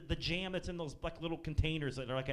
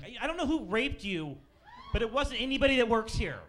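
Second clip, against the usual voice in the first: much louder, higher fast tirade; mock rant; 7 semitones higher, 8 dB louder than usual. Comic blasting the paranoid coffee woman, loud high rant.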